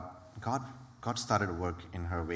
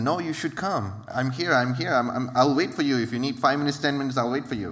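persistent; 5 semitones higher, 5 dB louder than usual. Retold or imitated. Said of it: "And she was persistent".

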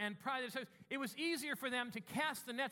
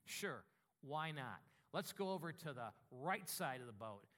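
resigned Peter; 8 semitones lower, 5 dB quieter than usual. Acted.

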